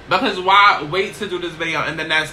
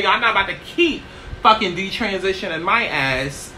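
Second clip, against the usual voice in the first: ordinary delivery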